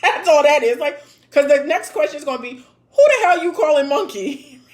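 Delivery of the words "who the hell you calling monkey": louder, indignant mock comeback; mock angry retort. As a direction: snap back at him, laughing. Imagined angry woman's reply.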